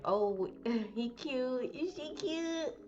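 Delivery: high, slow, swooning and giggly; lovestruck teen voice. Retold or imitated. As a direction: coo it like a smitten teen.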